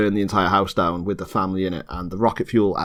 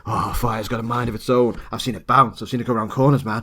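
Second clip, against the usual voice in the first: a hint of a besotted arson expert, faster; lusty fire expert; faster than usual. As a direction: rave about fire like a lover, lightly voiced. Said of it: "He's like".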